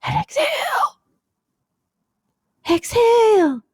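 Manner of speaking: high squeaky creature, slow and crying out; a goofy high puppet pops in; silly high character voice. Pretend. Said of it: "I was doing the relaxing bit".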